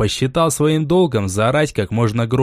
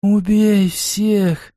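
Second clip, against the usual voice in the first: eerie, high, drawn-out whisper; furious whisper; 9 semitones higher, much slower than usual. A narrator's reading. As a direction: stretch the command out like an unearthly, raging whisper. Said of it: "услышали яростный шёпот".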